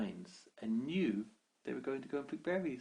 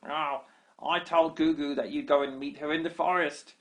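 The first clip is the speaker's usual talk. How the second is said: high, loud cackling goblin boast; gloating goblin; 6 semitones higher, 8 dB louder than usual. Acted. Baby goblin boasts to Sheila, high loud gleeful chant.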